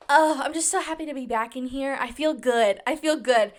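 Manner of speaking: ordinary delivery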